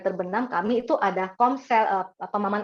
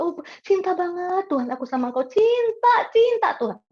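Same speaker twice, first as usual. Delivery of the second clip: gushing devotion; 7 semitones higher than usual. Retold or imitated. Mock gushing worshipper professing love.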